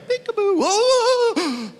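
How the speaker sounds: high, laughing peekaboo; playful peekaboo. Pretend. God doing little peekaboos, high and playful. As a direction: play peekaboo with a child. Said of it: "He just does little peekaboos".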